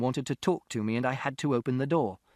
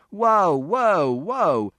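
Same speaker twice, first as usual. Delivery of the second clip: high, loud calls to stop; shouted whoa; 6 semitones higher, 8 dB louder, much slower than usual. A narrator's reading. Mister Shears stopping the fight, shouted whoa. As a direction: yell to hold them back. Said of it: "And Mister Shears shouted".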